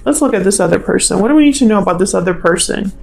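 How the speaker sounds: ordinary talk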